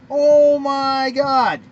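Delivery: loud, slow, stretched disbelief; drawn-out outraged cry. Real, not acted. Host outraged at LeVar Ball pulling his team, loud and slow. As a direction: let the outrage drag out.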